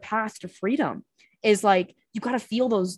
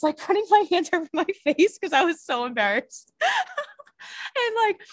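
laughing through the story, pitch jumping high; real embarrassed laughter breaking in; 12 semitones higher than usual. Real, not acted. Recalling crying on the train, laughing through it.

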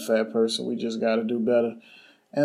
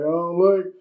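raised, louder mock quote of a dismissive guy; 4 semitones higher, 5 dB louder than usual.

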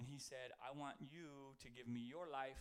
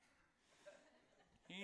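laughing; 5 semitones higher, 8 dB quieter than usual. Real, not acted. Teasing Jared mid-sermon.

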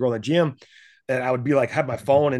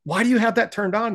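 high, louder disbelieving question; incredulous; 10 semitones higher, 4 dB louder than usual. Retold or imitated. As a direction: ask friends in disbelief why notifications are on. Retold question to friends.

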